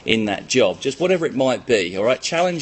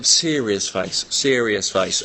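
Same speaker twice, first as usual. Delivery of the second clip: mock serious; 4 semitones lower, much slower than usual. Real, not acted. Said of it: "I'm trying to be serious here".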